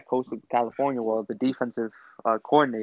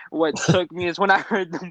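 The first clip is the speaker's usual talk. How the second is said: ordinary talk, nothing marked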